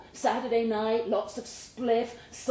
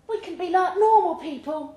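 put-on higher and louder, a young woman full of hope; Michelle's voice; 10 semitones higher, 8 dB louder than usual. Michelle dreaming to Bay about normal life, higher, louder woman's voice.

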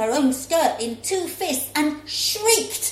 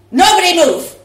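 loud, sharp order; loud commanding yell; commanding shout; 5 semitones higher, 14 dB louder, faster than usual. Acted. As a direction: freeze them all with it. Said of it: "she shouted".

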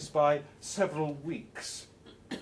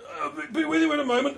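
high, fast, stumbling reply; distracted Harry; 11 semitones higher, 7 dB louder, much faster than usual. Harry answering Yvonne while studying his hand, distracted and fast. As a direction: brush her off while studying cards. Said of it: "says Harry".

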